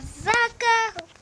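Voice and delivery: high, slow cry of "Socorro!"; a playful mock yell for help; a hammed-up cry for help; pretend scream. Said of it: "eu ia gritar".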